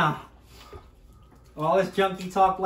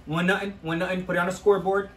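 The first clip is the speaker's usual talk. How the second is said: ordinary talk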